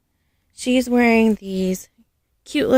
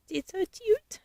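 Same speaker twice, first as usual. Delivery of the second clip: cooing; 11 semitones higher, 9 dB quieter than usual. Real, not acted. Cooing over a doll.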